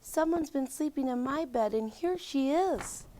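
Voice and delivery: high, soft little cub squeak; soft high baby bear squeak. A narrator's reading. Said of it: "and squeaked".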